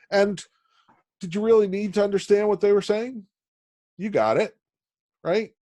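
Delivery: high, eager question to the class; rhetorical question. Real, not acted. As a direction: rise up as you ask the students. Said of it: "teacher asks the class".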